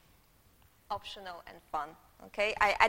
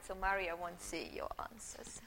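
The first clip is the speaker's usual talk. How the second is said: conspiratorial stage whisper; 3 semitones lower, 5 dB quieter than usual. Real, not acted. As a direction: let them in on a secret. Joking aside to the students.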